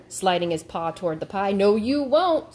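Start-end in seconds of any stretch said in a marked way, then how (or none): 1.5-2.5 loud, slow, high snarl. A narrator's reading. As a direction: snarl it as a greedy gator. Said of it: "snarled the fattest gator".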